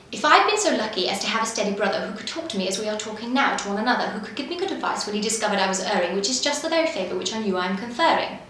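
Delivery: fast, crisp patter with every consonant sharp; rapid patter demo. Shown as a demonstration. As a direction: rattle it off fast but keep each word clear. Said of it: "So it goes something like this".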